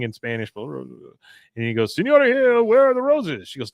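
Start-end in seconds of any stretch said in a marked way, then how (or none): 2.0-3.5 very high, loud mock distress; agitated exclamation. Husband finds the roses gone, high loud agitated question. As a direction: imitate a frantic man demanding, pitch way up.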